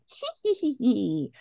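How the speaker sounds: very high, mock maniacal hee-hee; maniacal giggle. Host imitating Wyndham Earl in the scene, maniacal giggle. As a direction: imitate a crazed villain's high titter.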